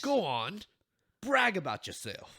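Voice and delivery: loud mock Australian greeting; hammed-up Aussie hello to fans; Australian accent. Podcast host doing an Australian accent for listeners. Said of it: "That's my best attempt at an accent".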